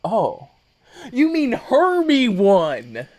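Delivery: loud, high, drawn-out dawning realization; hammed-up loud, high clarification; mock realization. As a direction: play a big slow "oh, you mean...". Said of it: "Then he was like".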